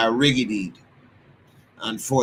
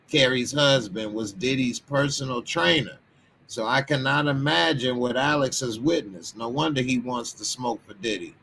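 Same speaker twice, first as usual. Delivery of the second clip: ordinary talk, nothing marked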